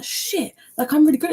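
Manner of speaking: high, softened mock gasp of a word; amazed realisation. Imagined crafter realising they are good at this, amazed. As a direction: act out a stunned, high-pitched discovery.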